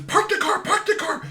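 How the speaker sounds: imitating an urgent, high-pitched shriek; wife's frantic high, loud demand, mimicked; frantic yell. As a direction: squeal the order in excited panic. Wife urgently demands a stop.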